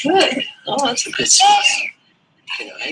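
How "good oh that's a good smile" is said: high delighted cry of praise; excited praise. Real, not acted. Parent praises toddler's smile.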